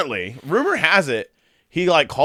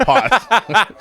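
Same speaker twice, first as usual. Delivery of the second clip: laughing; 11 semitones higher, 4 dB louder than usual. Real, not acted. Laughing while pausing clip.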